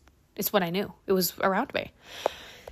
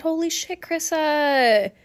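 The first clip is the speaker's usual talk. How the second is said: shocked realization; 6 semitones higher, slower than usual. Retold or imitated. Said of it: "And I said".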